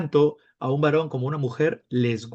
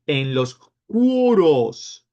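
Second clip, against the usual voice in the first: "cuoros" stretched long and louder; slow, emphatic reveal on "cuoros"; drawn-out key term; 5 dB louder, much slower than usual. Real, not acted. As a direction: announce CUOROS slowly with heavy weight.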